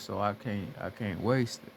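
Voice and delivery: usual speaking voice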